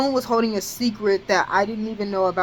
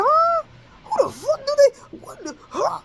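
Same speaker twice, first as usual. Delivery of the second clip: heated outburst. Retold or imitated. Retelling fuming at Tail's text, outraged yell. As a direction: blow up in furious disbelief. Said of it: "I was heated".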